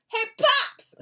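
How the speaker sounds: high, loud holler to get attention; yelled-out call. Retold or imitated. Volunteer calling from a car window to a man, yelled out. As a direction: call out loudly across a parking lot. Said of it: "and I yelled out".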